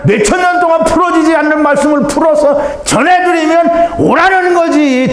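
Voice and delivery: high, slow, pleading sermon cry; fervent preaching. Real, not acted. Preacher pleading with listeners to answer the call, slow fervent cry. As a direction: plead with them to come, drawn out. Preacher urging at full force.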